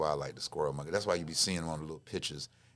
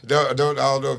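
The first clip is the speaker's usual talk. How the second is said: laughing, loud and high; 7 semitones higher, 14 dB louder than usual. Real, not acted. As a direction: talk through a burst of laughter. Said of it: "laughs while talking".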